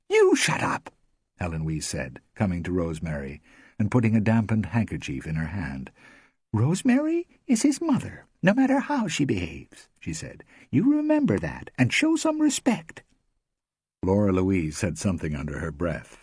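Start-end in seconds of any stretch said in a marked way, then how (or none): 0.1-0.9 high, loud snap; high, loud rebuke; sharp retort. A narrator's reading. Helen snaps back. 6.5-13.0 high, loud scolding woman; high, loud indignant scolding; stern rebuke. A narrator's reading. Helen rebukes Laura Louise.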